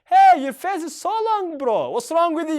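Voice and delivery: loud, high Hey, bro; loud concerned call. Shown as a demonstration. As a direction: call out to a sulking friend. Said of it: "fuula isaa buusee aariidhaan".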